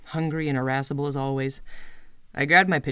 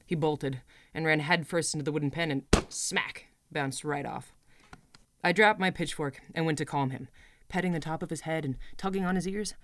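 ordinary delivery

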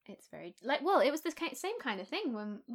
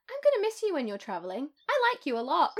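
imitating mum; 5 semitones higher, 6 dB louder than usual. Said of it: "out of the blue went".

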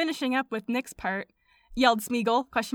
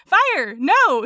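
mock protest; 5 semitones higher, 10 dB louder than usual. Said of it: "said another small man".

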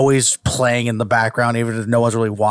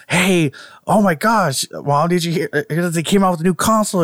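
put-on excited kid pleading; 5 semitones higher than usual. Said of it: "you would do little things like".

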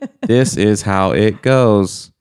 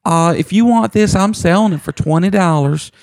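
put-on little old lady caller; 8 semitones higher than usual. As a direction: squeak out the sale like a sweet old Southern lady. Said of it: "a little lady. She'd be like".

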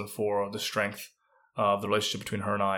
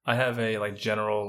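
usual speaking voice

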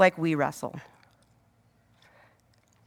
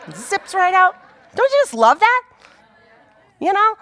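high, louder, gleeful; bubbling over with joy at the story; excited delight; 11 semitones higher, 4 dB louder than usual. Real, not acted. Preacher gushing to the congregation about Jesus vanishing, excited.

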